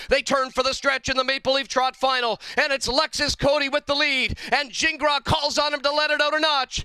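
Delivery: rising, building stretch call; excited race call. Real, not acted.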